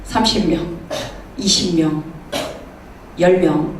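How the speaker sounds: imitating dwindling confidence; shrinking back. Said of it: "점점 이제 아브라함이 쪼그라드는 거야".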